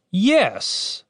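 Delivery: high-pitched mock agreement; sarcastic. A narrator's reading. Captain answering the cleric, sarcastic.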